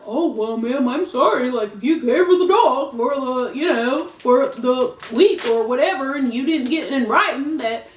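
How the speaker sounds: put-on country accent for an official. Police telling a dog owner sorry, country accent. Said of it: "I don't know why I got this country accent on".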